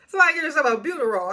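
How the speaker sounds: laughing through the line, quick. Real, not acted. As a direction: crack up while repeating it.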